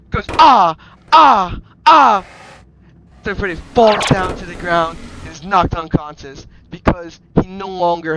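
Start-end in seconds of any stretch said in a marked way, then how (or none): 0.4-2.2 loud, high repeated yells; hammed-up cries of pain; acted hurt cries. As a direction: yell out on each pretend blow. Being hit many times.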